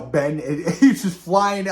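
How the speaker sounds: ordinary delivery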